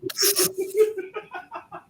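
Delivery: high, gleeful laugh at the blast; gleeful cackle. Real, not acted. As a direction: cackle with delight. GM cackles at explosion.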